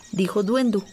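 plain reading voice

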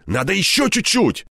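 high, urgent plea to wait; stubborn insistence. A narrator's reading. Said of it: "упрямо пытался остановить его я".

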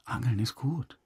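ordinary talk

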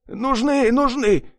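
high, frantic pleading yell; desperate yell. A narrator's reading. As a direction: beg frantically to keep his ears. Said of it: "заорал он". (отчаянный крик)

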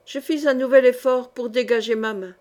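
usual speaking voice

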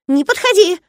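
thin squeaky high shriek; helium voice. A narrator's reading. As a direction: squeal it like a frightened runt. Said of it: "взвизгнул он тоненьким писклявым голоском". (тонкий писклявый визг)

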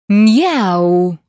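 a loud, put-out cat's meow; grumpy cat yowl. A narrator's reading. The enchanted cat yowls loud and displeased at the girl. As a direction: bark it like an annoyed cat. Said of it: "недовольно гаркнул кот".

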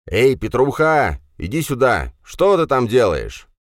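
loud, high, slow call across the field. Acted. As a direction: call him over from afar. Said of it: "окликнул его". (инженер громко окликает Петю издалека)

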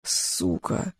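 a hoarse, breathless rasp; wheezing. Acted. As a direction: choke the word out while pinned down. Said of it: "хрипел Эльгар".

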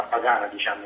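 normal talking voice

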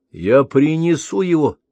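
light up with sudden hope; inspired. Acted. Prince promising to comfort his mother, inspired. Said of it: "Князь было вдохновился".

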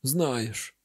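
low-key bitterness, falling tone; bitter. A narrator's reading. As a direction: echo it back with subdued bitterness. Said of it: "гірко прогомоніло на всю нашарошену хату".

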